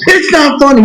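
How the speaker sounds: high-pitched mock snap; imitated indignant outburst; retold snapping. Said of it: "and snaps".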